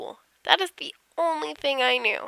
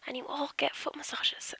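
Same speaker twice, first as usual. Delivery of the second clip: whispered aside; 3 semitones higher, 7 dB quieter than usual. Real, not acted. Giggly aside about the spa.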